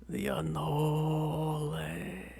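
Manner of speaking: very slow faraway ghostly call; dreamy distant voice. Acted. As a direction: draw it out like a distant echo. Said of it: "you think you hear a distant, distant, a distant voice go".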